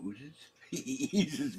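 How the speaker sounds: slow, chuckling self-correction; laughing at own slip. Real, not acted. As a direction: laugh off the wrong word. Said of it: "substitution words".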